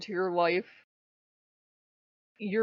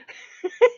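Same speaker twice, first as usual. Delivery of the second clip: high, loud cackle; loud high laugh; loud burst of laughter; 14 semitones higher, 10 dB louder than usual. Real, not acted.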